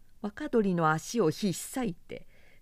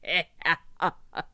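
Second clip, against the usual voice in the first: scornful laugh. A narrator's reading. Zhang Fei laughs at soldiers.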